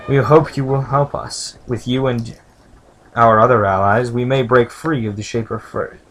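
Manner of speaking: lightly voiced game text read aloud; reading game dialogue. Player reading shopkeeper dialogue, lightly voiced. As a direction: read the lines like a story.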